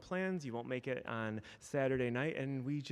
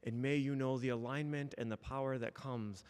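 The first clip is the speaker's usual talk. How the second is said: spoken blessing; 5 dB quieter than usual. Acted. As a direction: lay the blessing on them gently and unhurried. Minister speaks closing blessing.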